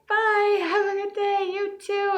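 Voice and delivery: high fake-cheerful farewell; forced cheery bye. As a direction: chirp it back through the pain. Her forced cheery reply.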